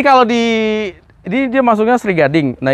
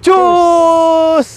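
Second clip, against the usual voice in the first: excited shout; 11 semitones higher, 3 dB louder than usual. Real, not acted. Host kicks off the review.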